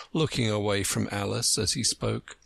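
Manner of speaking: plain reading voice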